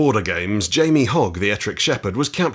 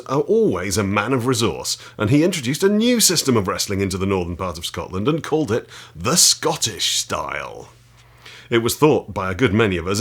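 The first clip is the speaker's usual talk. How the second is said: a firm, louder read-aloud voice; 4 dB louder than usual. Acted. Reading chapter six of the wrestling book to viewers, with relish. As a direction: read the old text out with relish.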